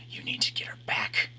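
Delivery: unvoiced ghostly whisper; eerie whisper. A narrator's reading. Reflection whispers to him to win her back. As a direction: breathe it like a voice inside his head.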